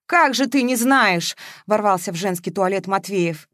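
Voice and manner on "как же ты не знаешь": higher, slow, incredulous question; exasperated demand. A narrator's reading. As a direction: burst in with a drawn-out demand. Man bursts in, protesting.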